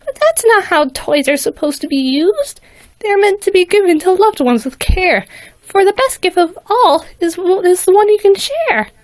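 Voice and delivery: put-on high earnest little girl voice; little filly. A narrator's reading. Filly explains sharing to Vader, high and sweet. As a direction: explain it sweetly like a small pony. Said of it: "became quite confused".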